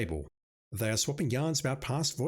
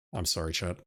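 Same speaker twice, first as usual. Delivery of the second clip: ordinary talk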